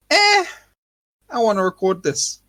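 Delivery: mock-nonchalant, high-pitched "eh" easing down; blasé onlooker. Podcaster mimicking bystanders who ignore the danger, blasé.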